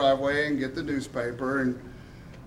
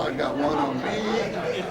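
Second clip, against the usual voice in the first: ordinary delivery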